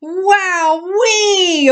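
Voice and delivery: high excited whoop; cried out. A narrator's reading. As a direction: let the joy burst out. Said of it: "Otis cried out".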